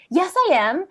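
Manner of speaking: bright, high confident "yes, I am". Retold or imitated. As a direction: declare it proudly. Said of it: "I said it with such confidence".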